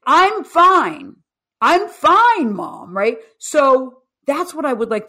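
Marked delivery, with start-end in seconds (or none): 0.1-2.9 much higher, louder, light and carefree; son reassuring mom. Retold or imitated. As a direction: tell mom he's fine, smiling. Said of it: "So he's saying".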